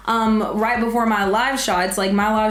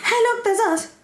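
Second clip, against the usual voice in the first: much higher, excited echo; high squeal; 13 semitones higher than usual. Real, not acted. Echoing line in high voice.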